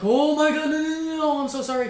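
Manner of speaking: high, loud, frantic pleading; mock panicked apology. Boy apologising to hurt friend, high panicked plea. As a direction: beg forgiveness in a panic.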